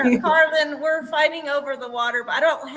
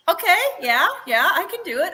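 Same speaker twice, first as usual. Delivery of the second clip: eager self-pep talk; 6 semitones higher, 4 dB louder than usual. Retold or imitated. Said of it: "I was like".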